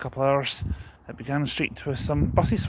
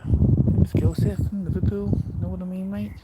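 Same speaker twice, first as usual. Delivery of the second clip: put-on Scouse accent; 7 semitones higher than usual. Vlogger retrying the Scouse accent after his friend's comment. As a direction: ham up the Liverpool Scouse voice. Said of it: "More of, like, a Scouser".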